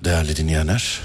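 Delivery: deep, measured cockpit announcement; airline pilot announcement. Shown as a demonstration. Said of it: "Pilotlar öyle girer ya mesela".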